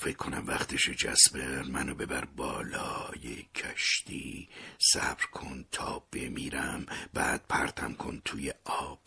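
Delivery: slow, faint unvoiced whisper; barely enough breath to ask; dying whisper. Dying father asking son to throw him overboard, faint whisper.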